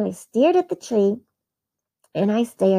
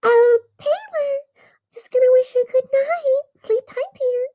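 tender mom; 14 semitones higher than usual. Retold or imitated. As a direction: coo goodnight like a loving mom. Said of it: "my mom came in to tuck me".

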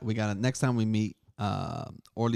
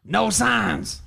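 gruff yell; 9 semitones higher, 6 dB louder than usual. Retold or imitated. Steve yelling from office back.

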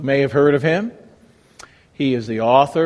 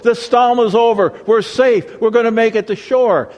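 higher, louder cheering relief; imagined joyful shout of relief; mock celebration; 7 semitones higher, 4 dB louder than usual. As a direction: play it like sailors high-fiving. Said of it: "backslapping and high-fiving".

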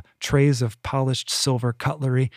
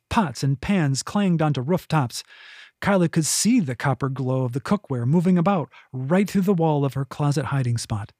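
ordinary delivery, nothing marked

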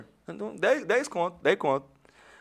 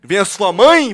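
loud, raised mock outrage; retold indignant question, pitched up; incredulous outburst; 5 semitones higher, 15 dB louder, slower than usual. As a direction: mockingly challenge the dealer. Retold outrage at dealer's price.

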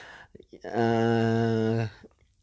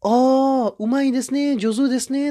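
high, loud gushing compliment; high, louder over-eager praise for a beginner; 13 semitones higher, 4 dB louder than usual. Retold or imitated. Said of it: "応援しちゃう".

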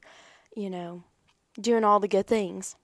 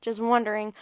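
ordinary talk

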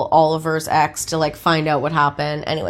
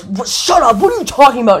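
imitating an angry man, higher-pitched; mock angry outburst; 6 semitones higher, faster than usual. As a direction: retell a heated, defensive comeback. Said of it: "he gets so angry".

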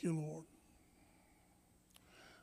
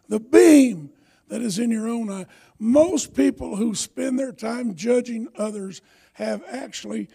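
loud, driving sermon delivery; preaching; 4 semitones higher, 12 dB louder, slower than usual. Acted. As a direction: preach it to the whole hall. Reads scripture and preaches hard.

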